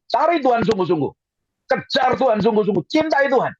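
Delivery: high, urgent exhortation; preaching at full force. Real, not acted. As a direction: scold and urge the congregation, pitched high. (khotbah menggebu-gebu, nada tinggi)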